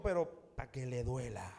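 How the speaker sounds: low, softened aside with a sting; pointed politeness. Acted. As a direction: drop the voice for a veiled jab. Polite reply meant to sting.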